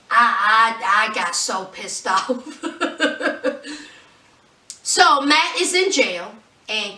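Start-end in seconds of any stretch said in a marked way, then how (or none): 2.7-3.7 breathy, unvoiced chuckle; a run of quiet laughter; laughing. Real, not acted. Host amused at own annoyance, laughing.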